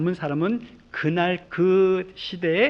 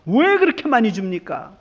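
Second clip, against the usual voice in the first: indignant workers; 5 semitones higher, 5 dB louder than usual. Retold or imitated. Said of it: "화를 냅니다".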